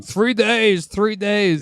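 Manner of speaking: retold yelling. Co-host imitating a cellmate yelling after Craven as he leaves, mock yell. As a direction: imitate a man calling out after someone. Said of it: "the guy's yelling".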